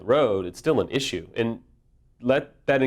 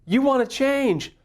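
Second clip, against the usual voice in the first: sarcastic sing-song, higher and slower; mock cheerful life voice; 11 semitones higher than usual. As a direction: play life as a fake helper, mockingly. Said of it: "life, energy, doesn't say".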